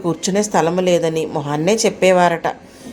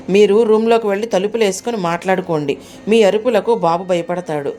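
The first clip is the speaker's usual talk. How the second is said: ordinary delivery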